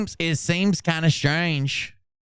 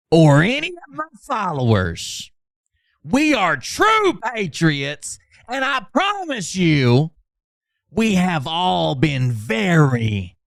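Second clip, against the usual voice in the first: mock preacher read; 4 semitones higher, slower than usual. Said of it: "reading a chat message aloud".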